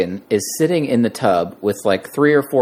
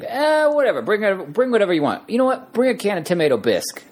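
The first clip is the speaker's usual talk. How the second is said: much higher, offhand actor impression; Christopher Walken; 9 semitones higher than usual. Actor tells crew what to bring, high-pitched impression. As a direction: imitate a star casually inviting guests. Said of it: "Christopher Walken says".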